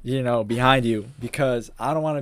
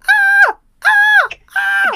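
shrill falsetto scream of a rider; hammed-up scream from a ride; high mock scream; 12 dB louder, much slower than usual. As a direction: screech it way up high like a panicking rider. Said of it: "screaming, like".

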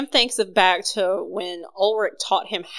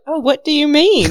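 put-on higher, louder German accent; 8 semitones higher, 5 dB louder than usual. Ulrich answering Beck innocently, a light German accent. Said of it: "Ulrich's like".

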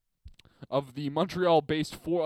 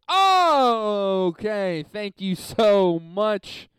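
loud, high, drawn-out okay; big overdone thanks; mock-grateful; 7 semitones higher, 9 dB louder, much slower than usual. Real, not acted. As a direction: thank them with heavy, slow sarcasm. Reacts to jibe in listener email.

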